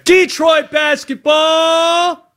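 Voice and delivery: high, drawn-out arena announcer holler; announcer shout. Acted. Host introducing noted Detroit basketball fan, drawn-out announcer shout. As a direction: belt it out long and high like a hype man.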